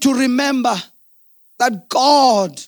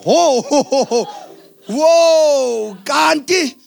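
amused, drawn-out whoa with a laugh; mock surprise; 3 semitones higher, much slower than usual. Husband amazed to see his brave wife crying, laughing mock surprise. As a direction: feign astonishment, laughing.

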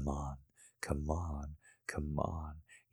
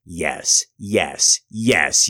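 building shout; 11 dB louder, much slower than usual. Acted. Shouting yes in scene.

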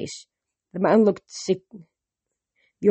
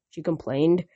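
ordinary delivery, nothing marked

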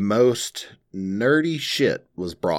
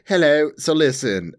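a quick, lightly put-on British accent, higher; mock British accent; 8 semitones higher, 4 dB louder than usual. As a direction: toss off a half-hearted British voice as a joke. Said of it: "I heard you put on that accent there, mate".

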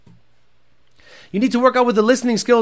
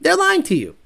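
mock warning; 8 semitones higher than usual. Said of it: "I'm gonna go".

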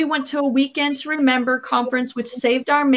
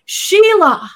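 higher, louder call of a name; sudden raised call; 6 semitones higher, 6 dB louder than usual. Retold or imitated. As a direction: call out to get her attention. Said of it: "I heard a voice, and it said".

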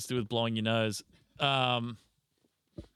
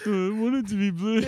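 high-pitched, louder mock child; whining kid impression; 10 semitones higher, 7 dB louder than usual. Imitating a kid defending a drawing, high mock-child voice. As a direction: play a little kid, high and loud through giggles. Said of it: "kids like".

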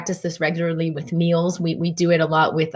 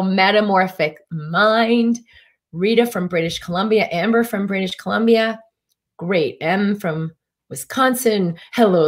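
ordinary delivery

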